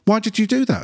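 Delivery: high demanding question; mock-outraged staff asking why; lightly voiced indignant staff. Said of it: "And they go".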